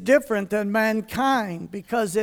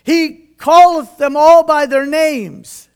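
loud, raised, emphatic proclamation; booming manly voice; 4 semitones higher, 11 dB louder than usual. Shown as a demonstration. Preacher re-reading scripture after the voice joke, booming manly voice. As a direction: boom out the verse with comic force. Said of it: "I got to lower my voice".

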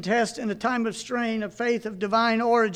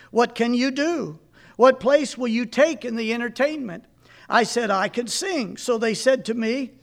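put-on reading an old sermon aloud to a congregation; 2 semitones higher, 6 dB louder than usual. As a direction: read it as a preacher retelling his own story.

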